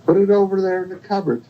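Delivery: high put-on granny voice; wolf posing as grandmother. Disguised wolf directing the girl, high put-on voice. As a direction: pitch it high, pretending to be grandmother. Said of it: "he says".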